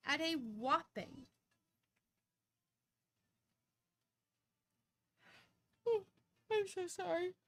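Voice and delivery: words stretched by a yawn. Real, not acted. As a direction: talk through a yawn. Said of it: "I felt that yawn coming".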